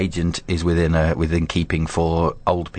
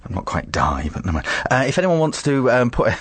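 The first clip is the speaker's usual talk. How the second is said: ordinary delivery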